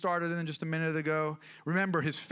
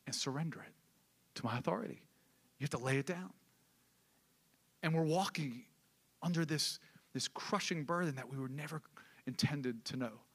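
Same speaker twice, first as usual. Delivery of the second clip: softly pleading with the congregation; soft and intimate; 2 semitones lower, 6 dB quieter than usual. Real, not acted. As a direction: drop to a hushed, near-whispered appeal. Preacher drops to soft.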